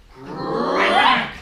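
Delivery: loud sharp crack sound. Acted. Narrator makes the walls crack with a loud sound.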